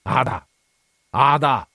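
growled call upstairs. A narrator's reading. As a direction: bellow the wife's name up the stairs. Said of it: "Ringhiò al piano di sopra". (chiamata ringhiata al piano di sopra)

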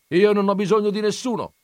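loud, high-pitched yell; emphatic cry hurled at someone; defiant shout. A narrator's reading. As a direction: shout it out, voice pushed right up. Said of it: "gridò mio zio a Juan Cabral".

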